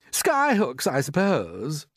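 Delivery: high start dropping into a slow sneer; jeering. A narrator's reading. Centipede mocking James's lifting plan, jeering. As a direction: jeer at the idea, dragging out the end. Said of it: "jeered the centipede".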